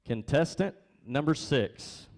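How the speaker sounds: usual speaking voice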